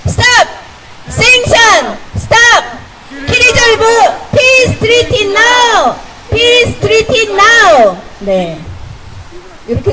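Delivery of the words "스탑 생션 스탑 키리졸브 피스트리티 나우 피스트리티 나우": high, drawn-out rally shouts in sequence; 구호 연달아 외치기. Real, not acted. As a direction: chant the slogans one after another, call and response. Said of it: "시작하겠습니다". (높은 목소리로 연이어 구호 제창)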